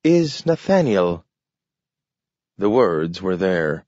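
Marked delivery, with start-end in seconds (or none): 0.0-1.2 high, talking dog; a dog speaking in a high voice. A narrator's reading. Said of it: "he said".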